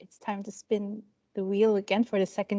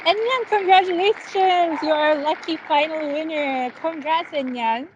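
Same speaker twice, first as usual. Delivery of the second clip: excited winner announcement; 6 semitones higher than usual. Real, not acted. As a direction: cheer the final winner's name. Final lucky draw winner reveal.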